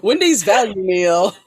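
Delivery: loud, slowed, said through laughter; laughing. Real, not acted. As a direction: draw it out loudly while laughing. Both laugh over cheap meals.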